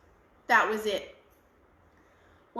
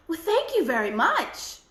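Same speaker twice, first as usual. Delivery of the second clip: put-on girl voice; 6 semitones higher, 4 dB louder than usual. Girl thanks old woman.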